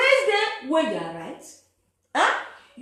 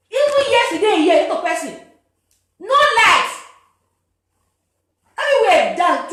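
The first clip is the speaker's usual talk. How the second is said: raised voice, high and loud; loud ranting; 6 semitones higher, 11 dB louder than usual. Real, not acted. Man complaining to viewers about no light, loud rant. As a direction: call out the darkness in exasperation.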